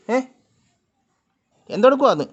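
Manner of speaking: loud, high demand for an answer; insistent questioning. Real, not acted. Repeats question to the cat.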